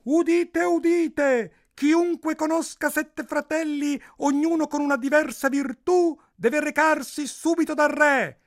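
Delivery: high, slow, projected proclamation; town crier. A narrator's reading. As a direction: cry the royal decree through the streets. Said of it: "uscì subito un bando".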